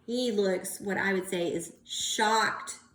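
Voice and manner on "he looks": plain reading voice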